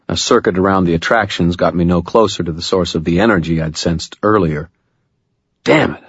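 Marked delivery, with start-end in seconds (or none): 5.6-6.0 a short frustrated curse. Acted. Harry failing to find the source, frustrated curse. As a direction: spit it out, annoyed at failing. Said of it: "I said, frustrated".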